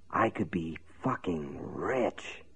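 low, slow, hushed and trembling; trembling whisper. A narrator's reading. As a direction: breathe it out slow, barely voiced. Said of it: "he said in a low, trembling whisper".

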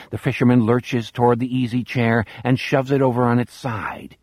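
usual speaking voice

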